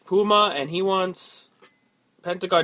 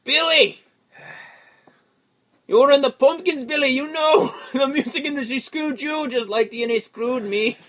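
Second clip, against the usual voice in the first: mock rant; 6 semitones higher than usual. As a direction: rib him like a fan gone overboard. Mock rant at [name].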